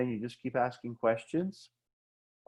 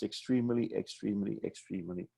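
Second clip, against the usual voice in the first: fading repetition; 3 semitones lower, 7 dB quieter than usual. Shown as a demonstration. Repeated word fading away.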